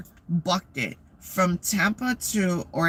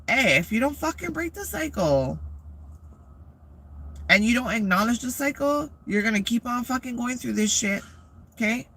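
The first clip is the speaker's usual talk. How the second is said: fired-up lecture; 6 semitones higher than usual. Real, not acted. Heated advice to the audience.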